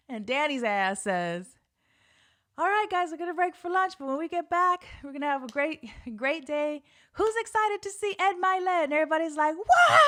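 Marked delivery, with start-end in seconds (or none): 2.6-8.8 loud, high hype-man announcing to a crowd; hyped host. Retold or imitated. Host hyping the lunch crowd, loud and high. 9.6-10.0 loud, very high mock cheer; crowd cheer. Whole room cheering for the star, shrieked.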